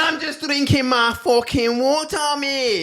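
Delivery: high, shouted, drawn-out mock Scottish; Scottish accent. Protesting about his drink, shouted mock Scottish accent. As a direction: holler it as a hammed-up Scot. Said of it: "Are you as good at accents?".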